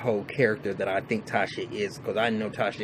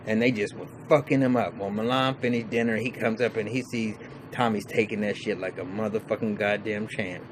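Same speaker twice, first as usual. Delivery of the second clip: ordinary talk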